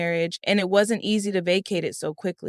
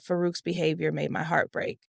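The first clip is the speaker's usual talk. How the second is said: ordinary delivery, nothing marked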